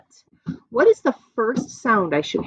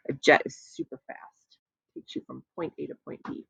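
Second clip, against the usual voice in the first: soft quick aside; 3 semitones lower, 9 dB quieter, faster than usual. Real, not acted. Quick aside during lesson.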